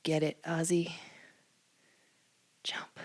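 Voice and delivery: half-whispered cue to the dog; hushed aside. Real, not acted. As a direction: quietly coax the dog, almost under your breath.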